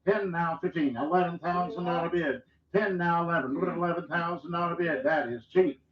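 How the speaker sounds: rapid rhythmic auction chant; auctioneer. Acted. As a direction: roll the numbers like a bid caller. Livestock auction bid calling.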